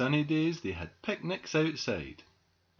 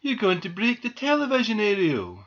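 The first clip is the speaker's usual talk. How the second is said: high, raised warning voice; scolding shout; 10 semitones higher, 6 dB louder than usual. A narrator's reading. Doll scolds squirrel near the aerial, shouted warning. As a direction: call out a loud telling-off. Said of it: "shouted Marianne".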